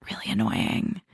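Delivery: whispered aside. Real, not acted. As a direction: whisper it, catty and secret. Catty aside about Bri.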